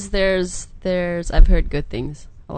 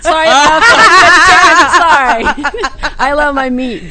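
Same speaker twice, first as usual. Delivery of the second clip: laughing loud apology; 8 semitones higher, 12 dB louder than usual. Real, not acted.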